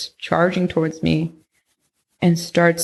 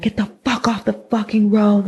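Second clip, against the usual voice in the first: higher, a hammed-up angry yell; mock yell; 4 semitones higher than usual. Skater retelling the old man's shout, higher mock yell. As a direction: play the scooter driver cursing her off the road. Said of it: "I hear yelling".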